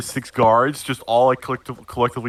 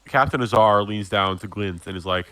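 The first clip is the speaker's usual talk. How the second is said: ordinary talk, nothing marked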